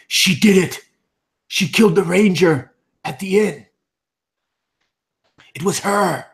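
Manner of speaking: high, loud desperate accusation; frantic accusation. Acted. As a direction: press the claim right in his face. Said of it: "And he's like".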